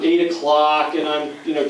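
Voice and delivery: ordinary talk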